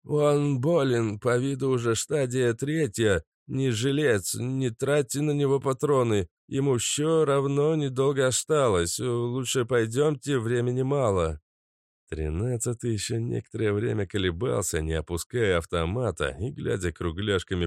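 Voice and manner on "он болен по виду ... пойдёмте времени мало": slight lisp, breathing through a gas mask; higher, slightly lisping voice behind a respirator; slushy sh for s. Acted. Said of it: "Сказал чуть шепелявым голосом, тяжело дыша сквозь банку фильтра".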